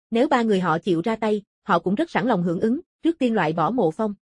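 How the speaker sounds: plain reading voice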